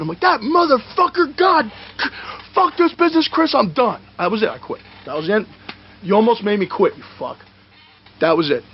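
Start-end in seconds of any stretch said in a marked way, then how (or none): 0.2-4.0 high-pitched acted tirade; retold flipping out. Wrestler quitting backstage to his colleague, retold furious tirade. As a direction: rant at high pitch in a reenacted meltdown. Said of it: "I'm flipping out".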